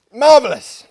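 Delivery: a loud, high burst of relish; delighted exclamation. Real, not acted. Tasting a tart drink, a loud high delighted exclamation. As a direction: savour it out loud, approving.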